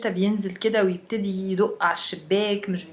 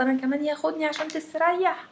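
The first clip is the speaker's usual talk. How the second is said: wailing mother; 8 semitones higher than usual. Retold or imitated. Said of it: "تروح مصوتة وتقول له".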